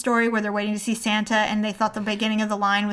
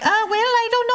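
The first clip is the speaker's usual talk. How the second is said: flustered husband; 12 semitones higher, 4 dB louder than usual. Retold or imitated. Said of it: "And he's like".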